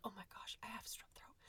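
hushed, unvoiced dread; whispered realization. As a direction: whisper it as if your throat is raw. Découvre son angine, chuchoté.

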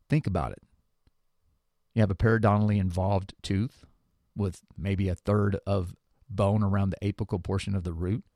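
ordinary delivery

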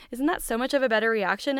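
ordinary delivery

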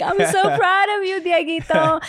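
high, loud doting mom voice; proud mama. Retold or imitated. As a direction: gush like a proud mother. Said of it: "I'm always like".

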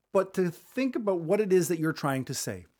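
ordinary talk

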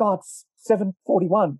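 high-pitched imitation of an excited reply; retold excitement. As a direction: pitch it up, relaying someone else's news. Retold friend answering with the time.

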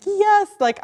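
a high, loud burst of joy on yes; excited yes. Retold or imitated. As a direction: cheer the yes like good news just landed. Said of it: "I was so excited".

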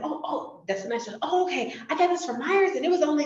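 flustered deflecting. Retold or imitated. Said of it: "'Cause if somebody said".

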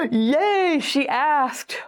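gasp, then high gleeful cheer; delighted excitement. Acted. Date who really likes you hears you asked, delighted excitement. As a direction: squeal it like a thrilled crush. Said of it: "they're gonna be like".

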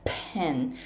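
ペン with an audible puff after the P; アスピレーション. Shown as a demonstration. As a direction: breathe out through the P of ペン. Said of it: "アスピレーション聞こえますか". (息の聞こえるPのペン)

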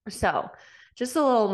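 normal talking voice

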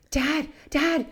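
high, loud panicked calling out for dad; retold yell. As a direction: yell it like a frightened teen shouting across the dock. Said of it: "the dad hears the older boy yelling".

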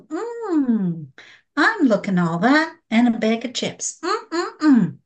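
hammed-up appreciative hum and strut; self-admiring. Woman admiring herself in the mirror, playfully smug. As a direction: savour your own reflection, pitch swooping on the hums. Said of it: "Am I going".

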